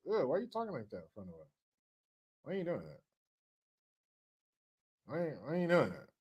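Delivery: hushed, quick imitation of appalled onlookers; mock uneasy audience. Crowd asks politician why he talks like that, mock-appalled and hushed. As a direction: drop to a low-volume, put-off murmur. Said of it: "They'd be like".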